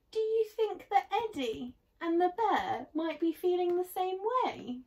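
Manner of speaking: ordinary delivery, nothing marked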